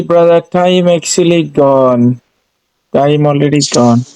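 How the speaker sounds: ordinary delivery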